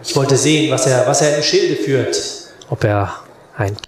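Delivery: usual speaking voice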